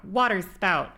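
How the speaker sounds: a light attempt at shouting like the mage; imitating Lux's spell yell. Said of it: "I wanted to yell, like".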